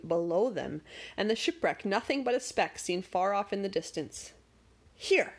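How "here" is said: declaring. A narrator's reading. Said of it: "declared Magic".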